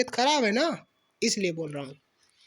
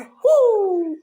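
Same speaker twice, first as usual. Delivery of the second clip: mock scolding grunt; 9 semitones higher, 10 dB louder than usual. Imitating the child's scolding noise.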